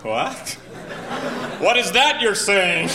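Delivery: slow, high, laughing confusion; baffled. Retold or imitated. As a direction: sound completely lost and amused. Confused tourist.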